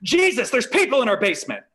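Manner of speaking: loud, high mock yell of alarm; God yelling. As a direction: holler it down to your son as God. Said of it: "God, you don't have to yell".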